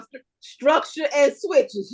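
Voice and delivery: drawn-out dictation to herself; note-taking repeat. Real, not acted. Cohost jotting down the advice, slow and deliberate. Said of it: "I'm writing that down".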